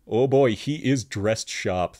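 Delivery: ordinary talk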